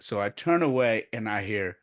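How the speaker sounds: ordinary delivery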